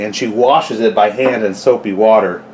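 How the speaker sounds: normal talking voice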